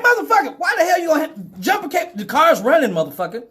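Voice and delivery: high-pitched outraged rant; furious disbelief. Retold or imitated. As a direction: chew him out in total disbelief. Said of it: "I'm like".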